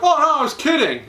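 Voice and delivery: high, teasing and amused; playful player. Retold or imitated. Outfielder telling the kid the bargain was a joke, high and playful. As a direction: let the kid off the hook, laughing it off.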